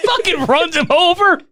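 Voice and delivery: high, squeaky laughing speech; helpless laughing. Real, not acted. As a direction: crack up while getting the words out. Cracking up at slow car scene.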